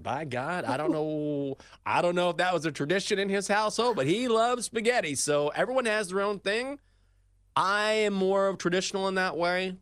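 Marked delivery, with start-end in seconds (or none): 1.9-9.8 higher and louder, tickled by the story; excited. Real, not acted. Host still amused after telling the story, excited and loud. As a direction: keep riding the laugh, pitched up.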